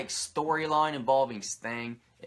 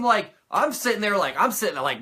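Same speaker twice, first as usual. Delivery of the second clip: ordinary delivery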